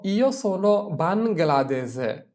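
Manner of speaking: very slow, careful; slow model answer. Shown as a demonstration. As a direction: drill it slowly. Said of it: "teacher models answer".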